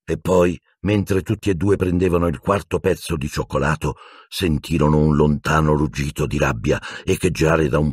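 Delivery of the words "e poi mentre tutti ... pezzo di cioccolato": ordinary delivery, nothing marked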